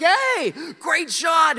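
high, loud hammed-up cheer; over-the-top retold shout of praise; excited game worker. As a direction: holler congratulations like a carnival barker. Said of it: "he's all excited".

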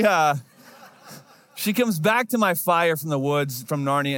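usual speaking voice

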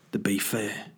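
lightly voiced gruff-bird impression; Ray Winstone. Imagined bird talking at the window, lightly imitated. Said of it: "'cause Ray Winston vo-, voices the birds".

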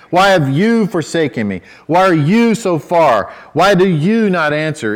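each question peaking on the word "you"; stress on YOU. Shown as a demonstration. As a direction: lean hard on "you" every time. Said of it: "do you see the personal pronoun you".

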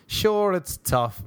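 high, slow, drawn-out mock lament. Comedian mocking the hardship of his lifestyle, mock lament. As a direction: stretch it out in hammed-up sorrow.

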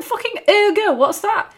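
incredulous, pitch jumping high; baffled. Retold or imitated. Hearing ergo for the first time, high incredulous. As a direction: disbelief at a strange word. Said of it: "I was like".

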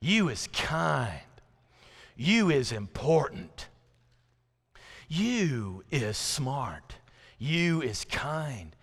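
slow, drawn-out nanny voice; softly, slowly reassure a little girl; nanny affirming a child. Retold or imitated. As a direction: say each line slowly, with long weight on YOU. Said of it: "this lady would look at her and say".

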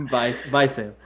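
laughing goodbye. Real, not acted. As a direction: laugh through the bye. Laughing at departing friend.

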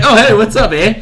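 high, loud joking Canadian greeting; mock Canadian. Awkward Canadian greets a lookalike in costume, loud mock-Canadian. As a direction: play an awkward Canadian saying hello. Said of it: "another awkward Canadian".

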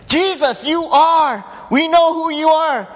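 high, loud cry of recognition; demons crying out. Acted. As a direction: call out in alarm like exposed demons.

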